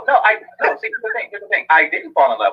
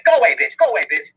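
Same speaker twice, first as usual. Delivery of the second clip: higher, curt brush-off; mock dismissal; 10 semitones higher than usual. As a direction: shoo someone away in a made-up voice. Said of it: "we just, you know, say".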